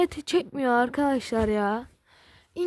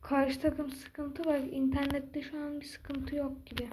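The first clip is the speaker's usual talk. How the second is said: whispering; 9 dB quieter than usual. Real, not acted. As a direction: drop to a whisper. Quiet talk outside door.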